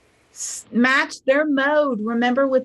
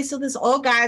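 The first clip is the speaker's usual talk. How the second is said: normal talking voice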